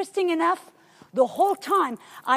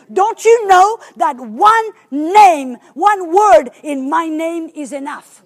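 slow, louder, scolding question; firm rebuke; 8 dB louder, slower than usual. Retold or imitated. Holy Spirit scolding the preacher, slow and firm. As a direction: scold him like a stern parent. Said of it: "a little more like, I got a spanking".

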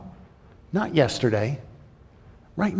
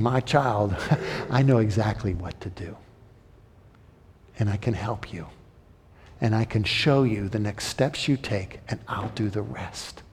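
soft, lower, gentle fatherly tone; tender reassurance; 4 semitones lower, 6 dB quieter than usual. Pretend. God reassuring his struggling child, soft and gentle. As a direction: soothe a frightened child.

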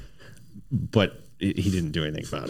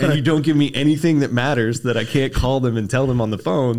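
raised, louder mock-defiant reply; retold heated answer; 6 semitones higher, 6 dB louder than usual. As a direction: argue back at the boss, higher and louder. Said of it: "And I'm like".

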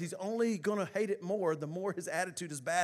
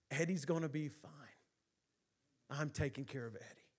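tender reassurance; 4 semitones lower, 8 dB quieter than usual. Retold or imitated. Jesus reassuring the children.

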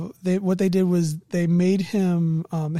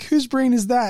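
alarmed question; 6 semitones higher, 4 dB louder than usual. Retold or imitated. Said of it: "they were like".

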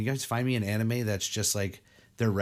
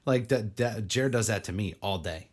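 ordinary talk

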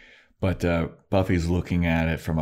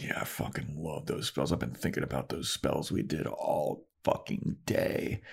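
much softer, breathy and intimate; hushed gushing; 3 semitones lower, 9 dB quieter than usual. Retold or imitated. Tara answering Willow about their spells, hushed and much softer. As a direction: murmur it like a shared secret. Tara's dreamy reply to Willow.

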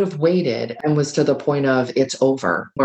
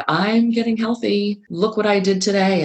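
bright, high, eager announcement; proud and excited; 7 semitones higher than usual. Retold or imitated. Parent announcing new diet to family, excitedly. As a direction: show them your healthy plate, beaming. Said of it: "and you're so excited".

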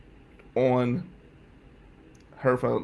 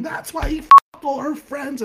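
loud, high imitation of mom's rant; screaming mom; 11 semitones higher, 9 dB louder than usual. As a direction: yell it like an enraged mother. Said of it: "my mom's screaming".

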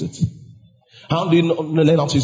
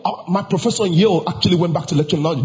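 normal talking voice

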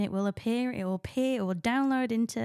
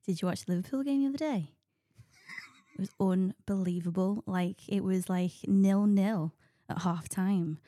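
put-on Liverpool accent; Scouse accent. Shown as a demonstration. Host demonstrating Scouse with a football story, attempted Liverpool accent. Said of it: "I could do Scouse".

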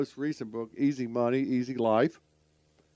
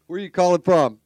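louder, repeating the question so the guest hears it; 6 semitones higher, 10 dB louder than usual. Real, not acted. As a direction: call it out louder over a bad line. Repeats question guest didn't hear.

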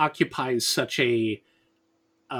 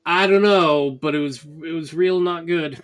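emphatic; 6 semitones higher, 6 dB louder than usual. Real, not acted. Emphatic verdict on bad show.

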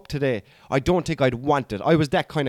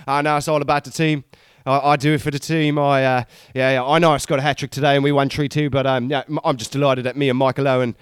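modest footballer interview, lower and halting; post-match interview voice; 3 semitones lower than usual. Retold or imitated. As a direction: imitate a player playing down his hat-trick. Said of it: "why I had that accent".

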